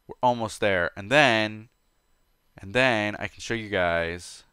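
normal talking voice

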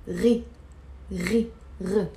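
"Ri" and "R" gargled in the throat; separated demo of "Ri", starting like a French R; throaty ghain sound. Shown as a demonstration. Teacher joining ghain to a vowel, throaty "Ri". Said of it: "how to pronounce it".

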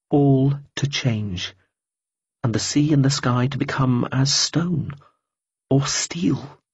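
slow, dreamy, half-chanted; slow intoned musing. A narrator's reading. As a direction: draw it out like a vision. Repeated, slow, far-off voice.

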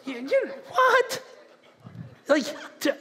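high, drawn-out incredulous "what?"; mock disbelief. Preacher to someone who won't make goals, mock disbelief. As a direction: squeak out "what?" in mock astonishment.

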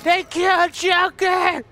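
high, slow, hammed-up wailing; mock grief wail. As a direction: mourn melodramatically, drawing out the words. Mock grief at game villain's death.

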